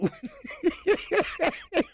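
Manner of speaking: high-pitched cackling laugh. Real, not acted. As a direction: cackle at the joke. Laughing at Gervonta's shoe line.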